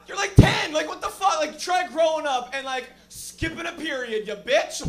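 high, loud mock rant; mock outrage. Comic rants at the girl in the front row, loud and high. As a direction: fire back at a kid in outrage.

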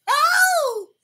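high, drawn-out "oh" holler; high whoop. Acted. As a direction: let out a whoop for the crowd. Whoop between lines to crowd.

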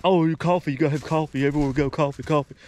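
lightly mimicking a nagging relative; nagging family member. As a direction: tease him about his coffee habit. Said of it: "from my family, saying that".

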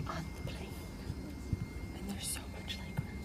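hushed whisper on a plane. Real, not acted. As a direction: whisper so seatmates don't hear. Quiet on the plane.

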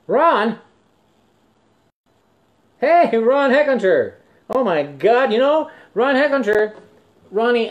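calling out. Real, not acted. Spotting old surfing buddy Ron, calling out to him excitedly. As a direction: call his name across the room, thrilled.

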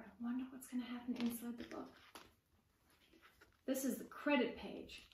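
ordinary delivery

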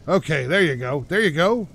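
ordinary talk, nothing marked

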